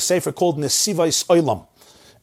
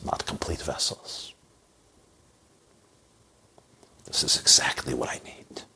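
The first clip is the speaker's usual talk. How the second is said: tender whisper; 7 semitones lower, 7 dB quieter than usual. Acted. King to his subject with the broken vessel, tender whisper. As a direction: confide it gently like a loving king.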